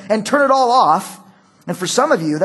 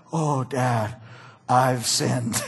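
lower, guilty little confession; sheepish son; 9 semitones lower, 6 dB quieter, much slower than usual. Retold or imitated. Son confessing to his dad, lower and sheepish. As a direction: play the guilty kid owning up.

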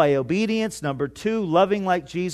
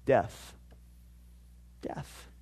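hushed pronouncement; 8 semitones lower, 14 dB quieter than usual. Acted. Stark one-word pronouncement.

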